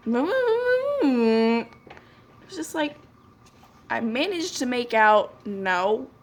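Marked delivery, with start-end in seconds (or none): 0.1-1.7 long, high wordless moan; imitated drawn-out moan. Clerk mimicking customer's answer about the bag, drawn-out high moan. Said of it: "This is exactly how he sounded".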